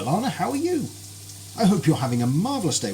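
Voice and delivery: normal talking voice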